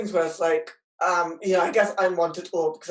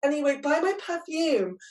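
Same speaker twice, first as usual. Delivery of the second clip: mocking celebrity ally; 7 semitones higher than usual. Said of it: "someone who's like".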